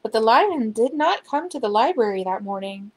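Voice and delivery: ordinary delivery, nothing marked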